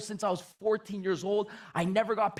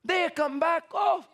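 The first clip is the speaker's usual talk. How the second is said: put-on high, excited nonna with Italian accent; Italian grandmother; 8 semitones higher than usual. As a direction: imitate grandma boasting about her sales. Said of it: "She says".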